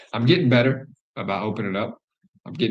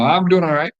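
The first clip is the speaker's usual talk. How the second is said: higher, louder breezy brush-off; fake fine; 9 semitones higher, 8 dB louder than usual. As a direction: cover up the pain with a bright answer. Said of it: "Fucking dying on the inside".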